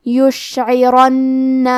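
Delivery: very slow یشعرن, full-mouthed heavy ra and a doubled nasal noon. Shown as a demonstration. Teacher modelling a heavy ra for students, very slow. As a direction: recite یشعرن slowly, ra thick, noon held two counts. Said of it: "را کو موٹی آواز میں".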